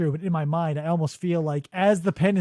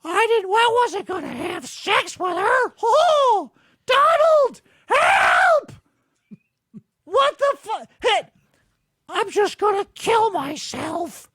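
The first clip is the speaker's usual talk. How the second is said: high panicked cartoon yell; squeaky panicked Mickey; 12 semitones higher than usual. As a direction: shriek for help like a cartoon mouse. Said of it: "Chris pops up and he's like".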